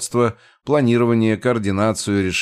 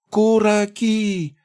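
very high, slow goblin shout; shouting farewell; 14 semitones higher, much slower than usual. A narrator's reading. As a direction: call out a drawn-out goodbye. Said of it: "прокричал он на прощание". (громкий прощальный крик)

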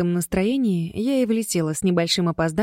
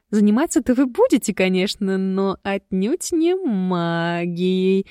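loud suggestive drawl, slow at end; meaningful drawl; 3 dB louder, slower than usual. Acted. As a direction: tease with a knowing drawl. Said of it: "многозначительно протянула подруга". (многозначительно протягивая)

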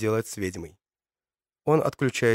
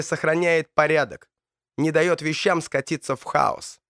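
menacing villain voice; 7 semitones higher than usual. Acted. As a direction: taunt him like a desperate tyrant. Donovan confronts James.

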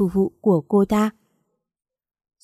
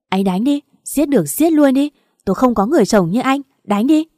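much higher, louder screaming dare; higher, louder desperate shout; hysterical dare; 5 semitones higher, 5 dB louder than usual. Acted. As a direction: scream at him to go ahead. Wife daring husband to hit.

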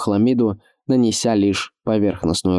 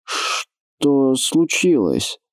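slow worried question; 5 semitones higher, much slower than usual. A narrator's reading. Worried keeper asks.

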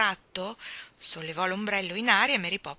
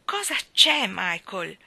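irritated; 8 dB louder, slower than usual. A narrator's reading. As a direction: snap at a child tugging at you. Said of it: "chiese irritata".

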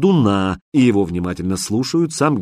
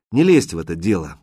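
usual speaking voice

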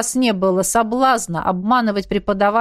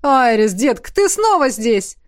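loud, higher-pitched amazed greeting; surprised; 4 semitones higher, 5 dB louder than usual. Acted. Coach seeing fast runner back already, surprised. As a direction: hail her in pleased amazement. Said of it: "удивился Рурк".

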